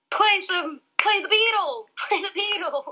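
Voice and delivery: high-pitched mock bar heckling; imitated heckler. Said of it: "And then he was like".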